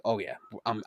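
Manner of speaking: ordinary talk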